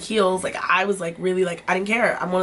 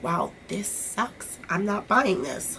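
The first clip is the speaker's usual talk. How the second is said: unimpressed; 6 dB quieter, much slower than usual. Retold or imitated. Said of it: "I'd be like".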